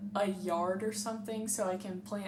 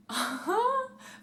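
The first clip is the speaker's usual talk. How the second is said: giddy high laugh; 13 semitones higher than usual. Real, not acted.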